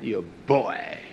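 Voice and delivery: ordinary talk, nothing marked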